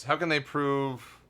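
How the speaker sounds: ordinary talk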